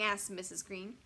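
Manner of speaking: ordinary delivery, nothing marked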